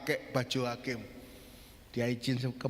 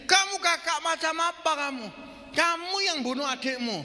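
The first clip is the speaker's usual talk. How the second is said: high, raised accusing cry; retold angry yell; 12 semitones higher, 4 dB louder than usual. Condemned brother to the judge, angry high yell. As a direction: yell it like an outraged younger brother. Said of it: "Dia teriak dari kursinya".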